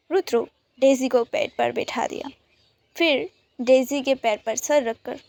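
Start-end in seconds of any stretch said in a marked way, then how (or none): none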